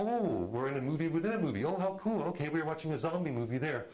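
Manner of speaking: thrilled to discover the twist; playful fake amazement, fast; mock excitement. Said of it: "And you go".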